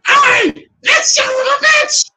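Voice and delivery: very high, loud screech; shrieked goblin outburst; goblin yelling. Acted. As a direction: scream it like an enraged goblin. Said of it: "He hears you yelling. He's like".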